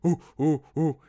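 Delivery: low hooting ooh like a monkey; low monkey noise. Shown as a demonstration. Teaching viewers the low monkey noise, hooting. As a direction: hoot it out, low and round. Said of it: "figure it out".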